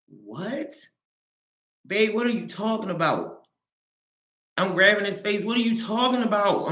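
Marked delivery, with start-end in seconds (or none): 0.1-3.5 retold disbelief. Retold disbelief at husband's news. 5.4-6.7 retold pleading question. Grabbing husband's face, demanding.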